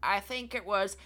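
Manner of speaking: hint of a hesitant little old lady. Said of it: "I pretended to be an old lady".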